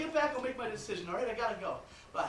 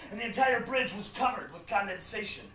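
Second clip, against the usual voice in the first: usual speaking voice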